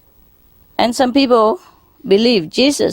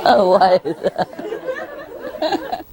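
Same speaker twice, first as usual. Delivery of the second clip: drawn-out question through laughter; can barely get it out for laughing; 12 semitones higher, 4 dB quieter, much slower than usual. Real, not acted. Laughing at his own joke.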